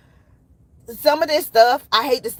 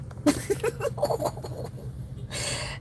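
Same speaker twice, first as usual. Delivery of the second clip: breathless giggle; 11 semitones higher, 11 dB quieter than usual. Real, not acted. Laughing hard at herself.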